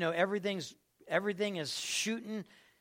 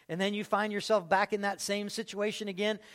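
ordinary talk, nothing marked

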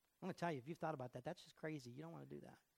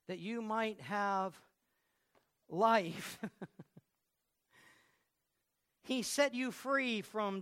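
slow, louder, rising preaching cry; full preaching; 3 semitones higher, 8 dB louder, slower than usual. Acted. Pastor proclaiming to the congregation, slow and loud. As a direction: build it up like a revival preacher.

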